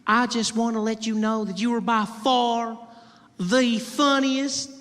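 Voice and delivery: hammed-up Southern belle, high and drawn out. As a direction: drag it out sweetly like a belle. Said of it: "She said".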